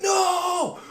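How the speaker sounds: high, loud squeal of mock horror with a laugh; laughing mock-horror yelp; mock horrified shriek. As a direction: shriek it like a moviegoer caught in the horror. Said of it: "You'd be like".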